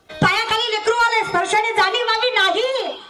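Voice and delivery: high, sustained dramatic narration; urgent storytelling. A narrator's reading. Preacher tells of the child underfoot, urgent. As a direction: raise the pitch for the dreadful moment.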